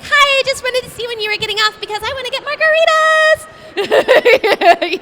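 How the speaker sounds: high, fast, bubbly rush; high and fast excited gushing; giddy excited caller. Retold or imitated. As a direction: gush like you can't wait for drinks. Excited call quote, high voice.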